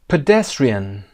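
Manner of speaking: PEDESTRIAN with a loud second syllable, DES; stress on DES. Shown as a demonstration. Teacher models the word, stress on DES in PEDESTRIAN. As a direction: punch DES in PEDESTRIAN, then fall. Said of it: "قسمت دس".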